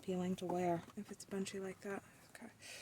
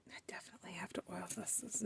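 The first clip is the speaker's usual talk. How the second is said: whisper; 10 dB quieter, faster than usual. Real, not acted. Whispering to herself.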